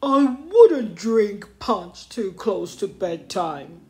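slow, loud character voice; advising. A narrator's reading. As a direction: advise slowly as a storybook ape. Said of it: "Norman advised".

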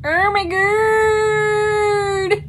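high, drawn-out squeal; excited squeal. Real, not acted. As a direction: squeal with delight. Gushing over new plant.

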